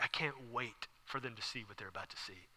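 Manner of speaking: very soft, low anticipation; contained, secretive excitement; hushed excitement. Acted. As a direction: confide your eager anticipation under your breath.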